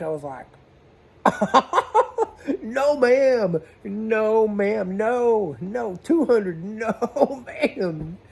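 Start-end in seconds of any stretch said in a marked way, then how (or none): 1.2-2.6 loud high burst of laughter. Real, not acted. Laughing at her check for five hundred, a high burst. 2.6-8.2 high, loud protest through laughter; laughing no ma'am. Retold or imitated. Telling the customer no, laughing loudly and high.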